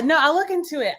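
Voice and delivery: ordinary talk, nothing marked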